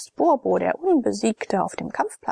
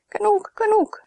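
much higher, louder sharp call to stop; Stratonike; 12 semitones higher, 3 dB louder than usual. A narrator's reading. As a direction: cut the men off like a bossy hostess. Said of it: "sagte Stratonike".

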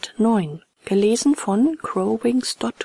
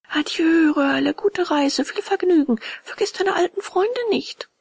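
put-on puppet boy's farewell; 10 semitones higher, 4 dB louder than usual. A narrator's reading. Puppet bids departing friend farewell, high calling voice.

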